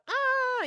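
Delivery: a short, high mock wail like a small child; imitated toddler cry. Toddler who fell sees mom and starts a mock wail. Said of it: "you know, crying".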